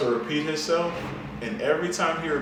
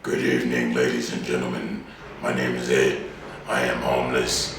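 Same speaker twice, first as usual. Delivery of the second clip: much deeper voice; 12 semitones lower than usual. Retold or imitated. Blind man begging on the train, much lower voice. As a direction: drop the voice way lower, like getting mad. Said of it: "and deeper".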